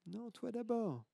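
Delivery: high, soft faraway repeat; the retort coming back as an echo; faint distant echo. Acted. Echo answers the girl.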